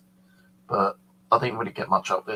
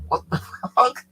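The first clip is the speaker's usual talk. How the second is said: high, loud, breathy mock disbelief with a laugh; retold gasp of shock, half laughing; imitated shock; 14 semitones higher, 5 dB louder than usual. Officer sees Maria headstone.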